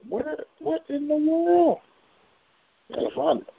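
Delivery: high, stretched-out bewilderment; baffled surprise. Real, not acted. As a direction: draw it out high, utterly puzzled. Baffled while searching for chart.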